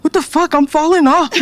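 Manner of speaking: high-pitched, hammed-up alarm; mock panic. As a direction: act out a comic freak-out, pitch jumping up. Acted reaction to getting a break.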